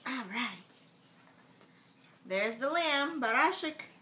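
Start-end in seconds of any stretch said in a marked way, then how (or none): none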